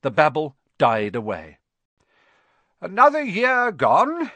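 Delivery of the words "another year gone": high, loud, drawn-out cheer; jovial headmaster opening a speech; cheerful. A narrator's reading. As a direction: announce it grandly and cheerfully. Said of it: "Dumbledore said cheerfully".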